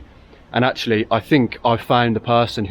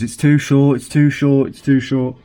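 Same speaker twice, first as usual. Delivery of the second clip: higher-pitched mock complaint, repetitive; mock moaning; 5 semitones higher than usual. Mimicking Amazon reviewers moaning about the socket, mock whinge. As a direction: imitate moaning reviewers, harping on it. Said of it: "were all moaning in the reviews".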